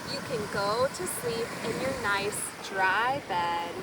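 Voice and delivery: ordinary delivery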